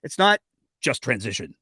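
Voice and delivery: a corrective "It's not" with "just transition" trailing off low; stress on not. Shown as a demonstration. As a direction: hit "not" hard, then let "just transition" fall. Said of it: "so I have to say it again".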